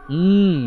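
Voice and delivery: high, loud muffled moan through closed lips; strained moan. Retold or imitated. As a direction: moan as if you cannot open your mouth. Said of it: "Parang ungol lang".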